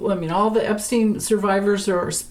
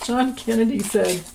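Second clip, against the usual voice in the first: ordinary delivery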